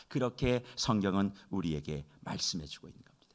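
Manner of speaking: whisper. Real, not acted. Hushed aside in a sermon.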